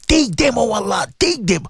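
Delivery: ordinary delivery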